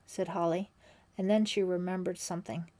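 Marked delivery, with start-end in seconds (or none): none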